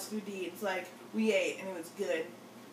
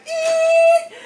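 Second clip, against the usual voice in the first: very loud, high sustained squeal; screeching brakes imitation; 19 dB louder than usual. Imitating the driver braking for her, loud high screech. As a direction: squeal like tires stopping short.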